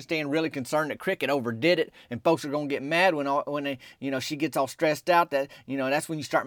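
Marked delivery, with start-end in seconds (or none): none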